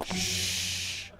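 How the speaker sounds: a quick unvoiced shush. Player shushes the others during the reading, quick whispered shush. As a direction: hush them, barely any voice. Hushing the coach mid-scene.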